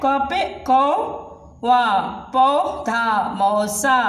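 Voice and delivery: slow, drawn-out teaching read; slow spelled-out words. Shown as a demonstration. Teacher slowly sounding out words for children. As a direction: stretch each word out for the class. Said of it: "ये double मात्रा है".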